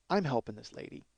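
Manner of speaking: normal talking voice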